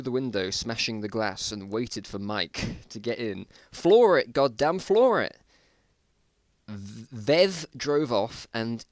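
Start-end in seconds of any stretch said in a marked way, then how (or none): 3.7-5.4 loud urgent high shout; frantic yell. Acted. Mike screaming at the driver to floor it.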